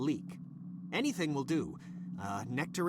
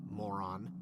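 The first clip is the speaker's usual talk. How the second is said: muttered insult; 11 semitones lower, 6 dB quieter than usual. Acted. Tacked-on insult to assistant.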